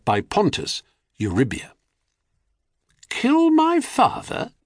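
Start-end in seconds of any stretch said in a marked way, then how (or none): 3.1-4.5 high, slow, stunned question; shocked disbelief. A narrator's reading. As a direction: gasp it out in disbelief. Crius shocked at plan.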